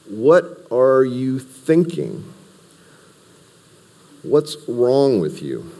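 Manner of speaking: slow, high exasperated disbelief; sarcastic. Retold or imitated. Paul scolding the deserting Galatians, slow sarcastic exasperation. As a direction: draw it out like a scolding. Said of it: "a little bit of sarcasm".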